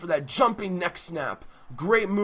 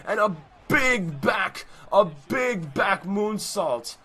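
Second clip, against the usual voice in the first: drawn-out shout, higher; slow hype shout; 4 semitones higher, slower than usual. Acted. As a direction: stretch the call out, hyped. Slow shouted big-move call.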